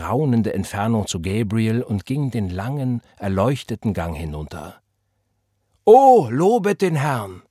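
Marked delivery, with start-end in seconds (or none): 5.8-7.4 slow, high cry of praise from the pews; called out. Acted. Someone in the congregation calling out praise. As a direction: shout your praise as the boy walks forward. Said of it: "rief jemand".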